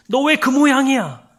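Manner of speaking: loud, high rebuke; scolding. Pretend. A harsh scolder rebuking a failing person, loud. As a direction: snap at them in reproach. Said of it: "라고 꾸짖지 않으세요".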